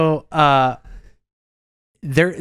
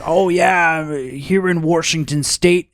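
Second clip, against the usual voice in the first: higher, drawn-out mock grandpa; imitating grandpa; 5 semitones higher, slower than usual. Grandpa talking of his state, slow mock voice. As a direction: Washington with an added R, "Warshington". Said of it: "you would be always".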